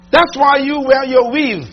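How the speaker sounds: ordinary talk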